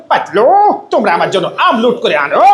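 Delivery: high cartoon character voice; Motu impression. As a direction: play a hungry cartoon character calling out. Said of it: "তখন মোটু কিভাবে কথা বলে".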